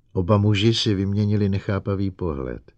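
plain reading voice

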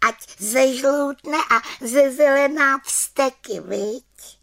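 high, eager little witch plotting; vengeful little witch. Acted. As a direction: relish the revenge like a young witch. Witch vows revenge. (malá čarodějnice se zlomyslně těší)

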